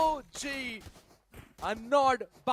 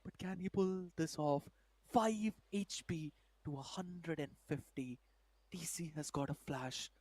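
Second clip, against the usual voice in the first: tense hush; 9 semitones lower, 8 dB quieter than usual. Real, not acted. Tense clutch countdown.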